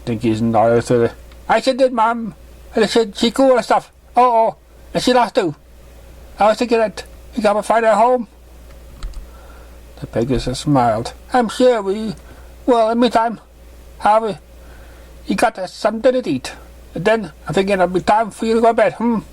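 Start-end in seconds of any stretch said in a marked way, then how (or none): 1.5-8.3 a bright little filly voice, pitched well up; high filly voice. Acted. Curious filly questioning the stranger in a high voice. 11.3-19.2 higher, gentle mother's voice; motherly mare voice. Acted. Mother greeting her daughter's new guest, higher voice.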